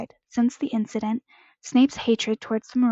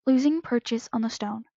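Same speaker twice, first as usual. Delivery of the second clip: ordinary delivery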